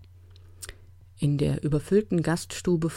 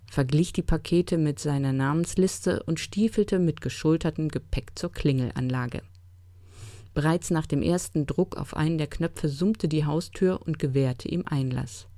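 ordinary delivery

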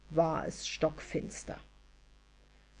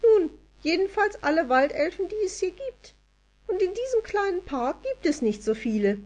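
much higher, sulky fairy voice; grumpy elf; 12 semitones higher than usual. A narrator's reading. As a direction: grumble like a miffed tiny elf. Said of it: "fuhr missgelaunt fort".

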